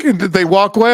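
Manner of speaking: ordinary delivery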